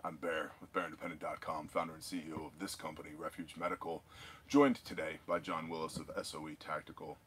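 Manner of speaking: low, very quiet show intro; deep, subdued news-host intro; professional announcer. Acted. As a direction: open the broadcast in a hushed, low professional voice. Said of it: "We have to sound very professional".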